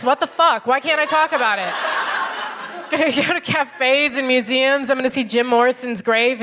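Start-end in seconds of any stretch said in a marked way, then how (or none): none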